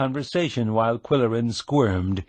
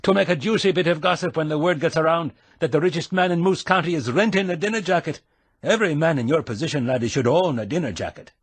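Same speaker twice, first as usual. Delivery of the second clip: fast, higher teasing Scottish brogue; 7 semitones higher, faster than usual. A narrator's reading. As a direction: needle him in a quick Scottish brogue. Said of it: "putting on the brogue".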